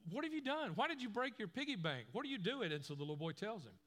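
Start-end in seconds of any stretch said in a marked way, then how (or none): 0.1-2.7 much higher, alarmed questions; shocked father at the broken piggy bank; dad startled to find the smashed piggy bank. Retold or imitated. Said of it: "And the dad says".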